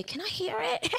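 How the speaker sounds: small, high, timid question; mock-timid squeak, softly asked with a laugh; retold nervousness. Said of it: "I was so nervous".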